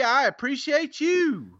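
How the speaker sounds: high, drawn-out sing-out thanks; showy sign-off to a reviewer; catchphrase flourish. Real, not acted. Same catchphrase to next reviewer.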